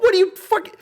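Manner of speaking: high-pitched incredulous outburst; exasperated. Retold or imitated. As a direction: retell it as a baffled, cut-off retort. Retorting to fans who cry traitor.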